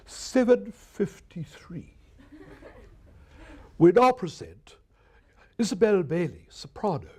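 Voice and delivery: lightly imitated announcer introducing a singer; old radio announcer. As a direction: announce the next singer stiffly. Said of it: "the announcers used to speak that way".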